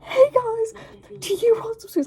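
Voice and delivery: high, loud bubbly greeting; overexcited welcome at the door; full energetic. Retold or imitated. Drunk narrator greeting trick-or-treaters, full energetic. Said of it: "Like, full energetic".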